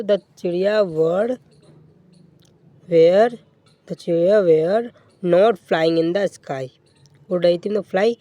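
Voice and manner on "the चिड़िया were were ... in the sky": slow, word-by-word dictation. Shown as a demonstration. Teacher dictating the English sentence to the class slowly. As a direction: spell it out slowly for students.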